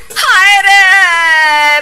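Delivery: long, loud drawn-out wail; anguished wail. Acted. Mother-in-law wails loudly as Ritu falls on her. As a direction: howl it out slowly in pain.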